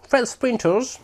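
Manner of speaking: playful English accent showing off the name. Shown as a demonstration. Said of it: "T'as vu un peu mon accent".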